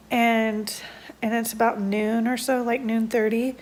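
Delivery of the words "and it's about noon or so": ordinary delivery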